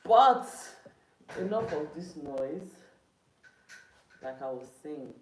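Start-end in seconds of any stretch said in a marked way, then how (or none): 0.0-2.7 loud, annoyed outburst. Real, not acted. Streamer ending a row at home, annoyed outburst. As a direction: burst out loud and high, then slow. Breaking off the quarrel.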